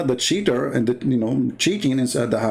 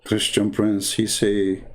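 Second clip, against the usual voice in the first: ordinary talk, nothing marked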